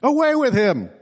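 high mock yell of the crowd; a hammed-up crowd cry, pitched high. A narrator's reading. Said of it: "they yelled".

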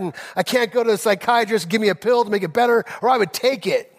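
pleading distressed plea to God; tearful prayer. Retold or imitated. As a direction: cry out to the Lord in frustration. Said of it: "And I'm crying".

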